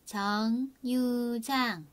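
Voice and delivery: 정류장 drawn out slowly, syllable by syllable; slow model word. Shown as a demonstration. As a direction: stretch 정류장 slowly for learners. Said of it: "chú ý cho mình những cái từ phát âm".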